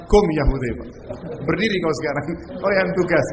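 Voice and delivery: loud commanding call; the Prophet ordering Hudzaifah to stand, loud and firm. Acted. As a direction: order him to his feet, loudly.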